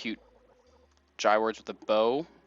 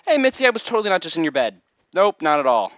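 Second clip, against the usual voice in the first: loud, high, rushed cover-up; mock guilty denial; 7 semitones higher, 8 dB louder than usual. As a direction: blurt an overdone innocent excuse. Mock denial after being caught.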